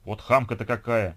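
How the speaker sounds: affronted, loud, drawn-out outburst; slow, loud huff of offence; indignant lady. A narrator's reading. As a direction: complain loudly about someone rude. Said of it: "наконец пришла в себя дама".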